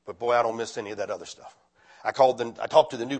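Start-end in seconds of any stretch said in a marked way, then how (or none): none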